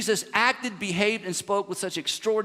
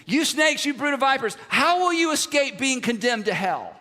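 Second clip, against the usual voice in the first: higher, louder furious shout; Jesus ranting; 5 semitones higher, 4 dB louder than usual. Retold or imitated. Jesus condemning the temple leaders, shouting in anger. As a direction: denounce them with full force. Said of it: "He says".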